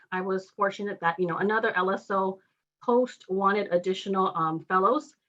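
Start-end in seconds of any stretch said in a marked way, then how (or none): none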